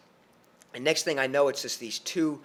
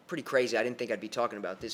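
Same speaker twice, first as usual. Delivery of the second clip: ordinary talk, nothing marked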